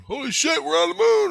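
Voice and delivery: a high, loud, thrilled whoop from the moon; amazed Christ buddy. Retold or imitated. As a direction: squeal it out like a stunned friend on the moon. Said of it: "And he got up and said".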